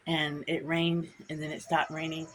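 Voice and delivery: normal talking voice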